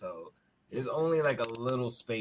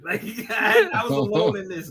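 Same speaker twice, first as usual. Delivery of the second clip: laughing; 8 semitones higher, 10 dB louder than usual. Real, not acted. Laughing at scared cop.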